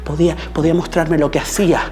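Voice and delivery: from emphatic to loud, high declamation; impassioned crescendo. Acted. Actor recalling mother before audience and critics, impassioned crescendo.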